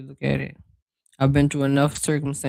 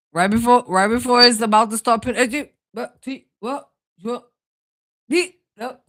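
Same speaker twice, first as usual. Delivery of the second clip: flustered high; 8 semitones higher, 5 dB louder than usual. Real, not acted. Flustered high-pitched exclamations.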